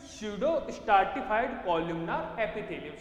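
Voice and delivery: drawn-out term for students to write; slow dictation. Shown as a demonstration. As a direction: spell it out slowly. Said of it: "किसकी lining रहती है बेटा?".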